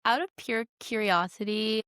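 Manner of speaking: usual speaking voice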